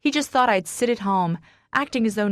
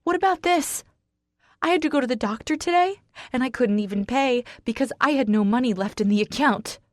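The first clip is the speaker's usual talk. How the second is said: yelling; 6 semitones higher, faster than usual. A narrator's reading. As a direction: pour it out in a yell. Yelling at husband.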